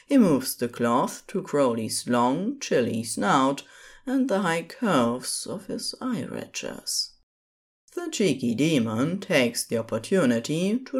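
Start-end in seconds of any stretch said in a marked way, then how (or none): none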